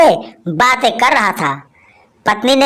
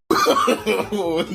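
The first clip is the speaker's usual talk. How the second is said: soft laugh; 5 semitones lower, 9 dB quieter than usual. Real, not acted. Laughs after joke.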